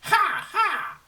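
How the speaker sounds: high, loud cackling bird laugh. Acted. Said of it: "changed back into a bird and said".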